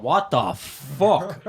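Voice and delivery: slow, drawn-out what-the-hell; stunned disbelief. Retold or imitated. Trainee stranded in the ring reacting, slow and stunned. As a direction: stretch it out in baffled shock. Partner leaves mid-match bleeding.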